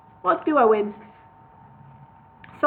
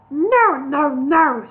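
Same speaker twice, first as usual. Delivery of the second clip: raised, louder, flustered protest; agitated; 4 semitones higher, 5 dB louder, much slower than usual. A narrator's reading. Solomon protests agitatedly, louder. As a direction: push back, getting worked up. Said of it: "becoming quite agitated".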